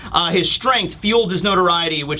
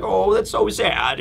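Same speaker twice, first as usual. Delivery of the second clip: sympathetic Connie. Retold or imitated. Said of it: "And so Connie is like".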